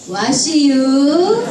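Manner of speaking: drawled, rising Chungcheong greeting; slow Chungcheong drawl; Chungcheong dialect. Acted. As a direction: stretch out 와시유 like a Chungcheong barber. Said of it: "충청도로 갔어요".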